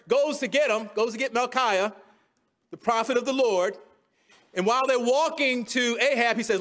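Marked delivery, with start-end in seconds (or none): none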